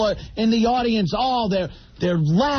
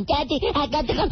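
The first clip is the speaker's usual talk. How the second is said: quick, higher mock child voice; little son; 7 semitones higher, 4 dB quieter, faster than usual. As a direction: lightly imitate an eager small child. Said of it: "He comes up to me and says".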